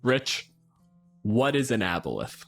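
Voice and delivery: ordinary talk, nothing marked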